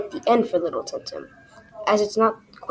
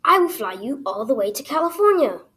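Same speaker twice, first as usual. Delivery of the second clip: raised, loud, emphatic promise; grateful; 5 semitones higher, 6 dB louder than usual. A narrator's reading. Older bluebird promises Little Tim Tim a flight, grateful. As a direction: declare it proudly and gratefully. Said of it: "was so grateful that he said".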